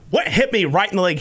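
ordinary delivery

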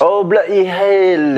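slow, hammed-up British accent; mock English bloke saying it slowly. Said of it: "What about the English?".

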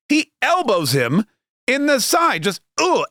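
ordinary talk

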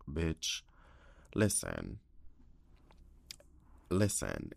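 soft and low, easing the bad news in; very gentle. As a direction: hush it down like breaking news gently to a friend. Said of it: "I say this very gently".

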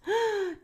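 sharp high gasp of shock; a quick startled intake of breath. A narrator's reading. Crowd at the cookie tent gasping in shock. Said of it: "Everyone gasped".

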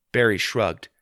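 ordinary delivery, nothing marked

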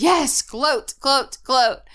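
high gleeful gloating; gloating witch. Retold or imitated. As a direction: relish every gloat. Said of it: "The witch says".

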